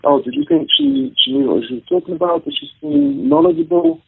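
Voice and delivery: high, mocking quote voice; lightly voiced impressed listener; a hint of a questioning audience member. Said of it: "she did one in a very posh, um, Oxford voice".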